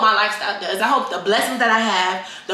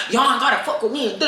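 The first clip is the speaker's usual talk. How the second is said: mocking influencer; 2 semitones higher than usual. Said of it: "influencers that be like".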